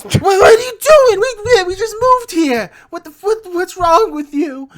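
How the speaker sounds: very high, loud panicked wail; hammed-up hysterical goblin, stammering through sobs; sobbing goblin. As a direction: wail it in terror at the attacker. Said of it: "he's like, he's sobbing".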